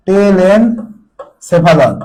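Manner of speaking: each syllable of টেলেনসেফালন drawn out very slowly; slow dictation. Shown as a demonstration. Teacher to students taking notes, টেলেনসেফালন stretched out very slowly. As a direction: spell out টেলেনসেফালন syllable by syllable for the class to write. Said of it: "লিখো".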